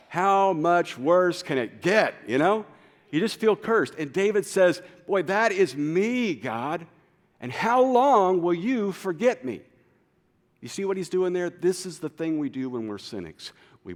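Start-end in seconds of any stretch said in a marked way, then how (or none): 0.1-3.9 exasperated. Acted. Venting about a cursed week. 5.1-9.6 loud, high, slow lament; fed-up cry, drawn out; exasperated complaint. Acted. Voicing David's complaint.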